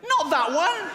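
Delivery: high, loud yelp of alarm; panicked shout. Retold or imitated. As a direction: cry out in horror to stop him. Host Ian panicking.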